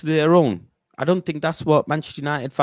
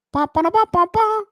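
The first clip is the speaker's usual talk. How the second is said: very high sung trumpet pa pa; high trumpet imitation; mock trumpet fanfare. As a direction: toot like a stadium band.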